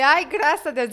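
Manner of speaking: ai, graças a Deus, said brightly relieved; relieved exclaim. Retold or imitated. Relief after getting the studio.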